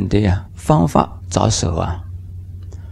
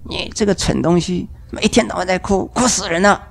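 mock scolding; 6 semitones higher, 3 dB quieter than usual. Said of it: "你骂他".

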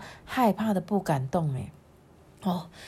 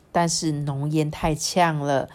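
usual speaking voice

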